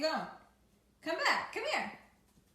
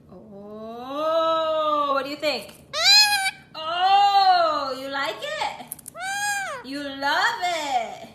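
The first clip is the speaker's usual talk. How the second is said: high, loud gushing at the dog, slowing on love it; real delighted pet talk; delighted squeal; 5 semitones higher, 12 dB louder, much slower than usual. Real, not acted. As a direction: gush over the pup like it's the cutest thing. Delighted at dog eating popcorn.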